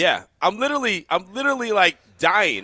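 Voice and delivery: choked up and watery-eyed; teary. Real, not acted. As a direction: talk through tears from coughing. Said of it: "My face is full of tears".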